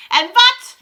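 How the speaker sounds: loud, high, dramatic demand; witch's shout. A narrator's reading. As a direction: bellow it at the crowd. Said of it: "shouted the Grand High Witch".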